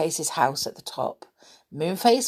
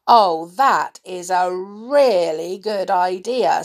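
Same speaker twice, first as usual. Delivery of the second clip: slow, loud, savoured approval; delighted; 6 dB louder, slower than usual. A narrator's reading. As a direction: relish the idea with delight.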